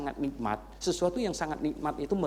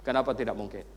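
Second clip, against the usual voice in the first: ordinary talk, nothing marked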